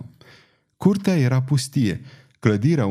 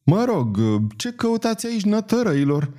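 put-on high-pitched, insistent old woman; lightly voiced cantankerous old nun; stubborn old crone; 8 semitones higher than usual. A narrator's reading. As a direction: demand an answer in a high voice. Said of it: "se încăpățână să-i întrebe babornița".

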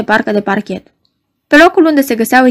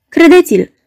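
pleading hard, higher and louder; rugăminte fierbinte; 6 semitones higher, 4 dB louder than usual. A narrator's reading. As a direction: beg him to believe it. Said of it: "îl ruga fierbinte bufetierul". (rugă fierbinte, mai sus și mai tare)

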